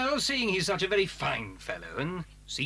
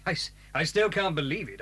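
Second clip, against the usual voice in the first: ordinary delivery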